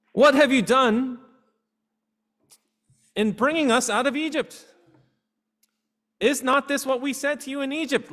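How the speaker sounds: high, loud accusing outcry; bitter complaint. Retold or imitated. Israelites accusing Moses, high and loud bitter complaint. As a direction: throw the complaint back at Moses. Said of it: "They say".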